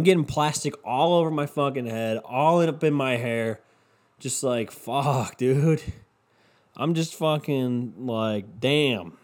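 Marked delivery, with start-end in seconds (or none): none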